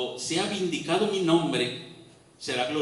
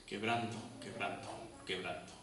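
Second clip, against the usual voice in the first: "quebranto" said three times, fading softer each time; emphatic repetition; 6 semitones lower, 13 dB quieter than usual. Shown as a demonstration. Preacher echoing Job's word "quebranto", softer each time. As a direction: let each "quebranto" sink quieter. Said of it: "si quieres enfatizar la palabra quebranto".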